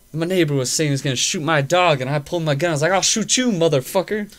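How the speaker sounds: loud, high imitation of an old drunk threatening; ranting old man. Retelling the old man's threat to his neighbor, loud mock bravado. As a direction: play the old man boasting he'd shoot the neighbor.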